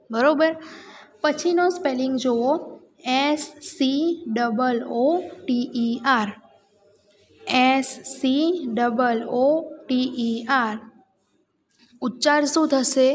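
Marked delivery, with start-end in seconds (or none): 3.2-6.3 very slow, letter-by-letter spelling; slow classroom spelling with gaps. Shown as a demonstration. Teacher spells word for class. 7.6-10.7 very slow, letter-by-letter spelling; slow classroom spelling with gaps. Shown as a demonstration. Teacher spells word for class.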